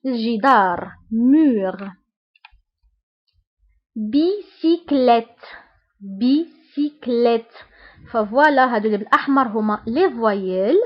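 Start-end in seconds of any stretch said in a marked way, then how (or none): 4.0-7.5 slow, careful model of a French word; measured teacher's demonstration; slow syllable-by-syllable. Shown as a demonstration. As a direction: sound it out for beginners, syllable by syllable. Teacher models a new word slowly.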